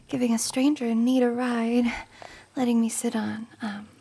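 usual speaking voice

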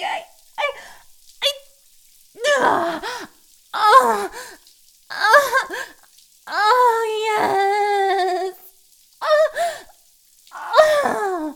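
rising cries of pleasure; loud moans. Acted. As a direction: let out high, loud gasping moans. Climax moans.

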